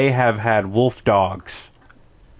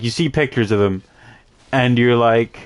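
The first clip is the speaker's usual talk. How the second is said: ordinary talk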